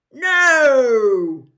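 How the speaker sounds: loud, high dismayed shout. A narrator's reading. Ron crying out as Cedric is picked, loud. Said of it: "said Ron loudly".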